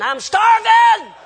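loud, high, drawn-out mock holler; child's hungry wail. Hungry son interrupts parents before the blessing, hollering in mock agony. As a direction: wail it like an impatient hungry kid.

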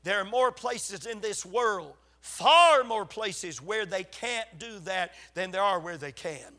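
ordinary talk

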